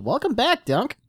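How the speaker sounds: ordinary delivery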